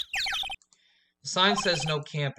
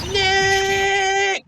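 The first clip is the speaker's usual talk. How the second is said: a much higher shouted name; 13 semitones higher, 5 dB louder than usual. Acted. Nick shouts his own name to set off the camera. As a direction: yell it up high at the mic.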